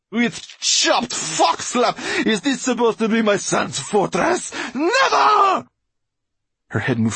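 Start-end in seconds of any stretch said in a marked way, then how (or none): 0.1-5.7 very high, slow, venomous screech; Mortola's furious exclamation. A narrator's reading. Mortola raging at her son's ruined fortress, furious screech. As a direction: build to a screamed refusal. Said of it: "she exclaimed".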